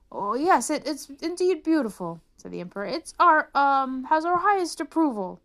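pompous emperor. Acted. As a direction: bluff approval, flustered. Emperor bluffing praise.

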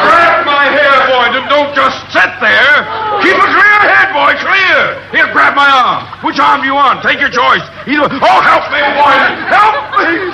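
clutching at anyone to save him; frantic pleading. Acted. Captain begs for rescue.

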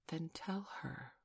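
soft, slow plea; hushed, imploring request; begging. A narrator's reading. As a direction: beg gently, drawing the words out. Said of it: "Wendy begged".

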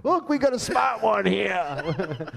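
slow, high mocking laugh; teasing. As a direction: tease them like a know-it-all. Teasing about metric system.

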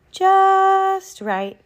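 high, loud, stretched JUST before right; storyteller relishing "just right"; drawn-out delight. A narrator's reading. Goldilocks finds the perfect bed.

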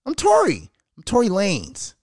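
high, boastful self-announcement; cocky Tory. Retold or imitated. Tory bragging at the party, high cocky crow. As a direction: crow your own name like a star.

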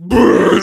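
loud mock puke noise; big sputtering retch imitation; imitated vomiting. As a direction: spew it out like a cartoon vomit. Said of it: "he just fucking throws up".

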